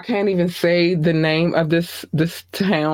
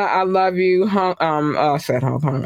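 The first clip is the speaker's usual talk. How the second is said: normal talking voice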